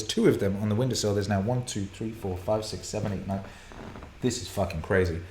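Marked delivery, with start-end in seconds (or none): none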